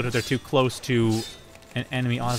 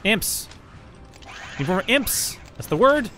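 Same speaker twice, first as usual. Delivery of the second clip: high, loud excited outburst; excited shouting; 10 semitones higher, 3 dB louder, slower than usual. Real, not acted. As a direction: blurt it out in sudden excitement. Excited discovery of the name.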